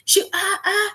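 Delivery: normal talking voice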